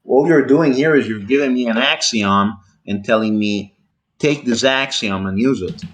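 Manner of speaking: ordinary delivery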